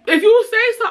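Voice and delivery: high, sarcastic brush-off; mock sarcastic. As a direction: toss it off high and mocking. Mocking retort in an argument.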